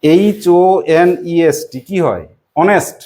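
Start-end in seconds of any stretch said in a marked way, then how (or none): 0.0-1.8 slow letter by letter spelling. Shown as a demonstration. Teacher spells the word slowly for the class. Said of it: "দেখো তো আমি একটা word লিখেছি".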